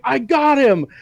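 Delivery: proud henchman yelling out; mock triumphant shout. Henchman catching Puss in Boots, triumphant shout. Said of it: "and is like".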